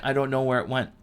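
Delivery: ordinary talk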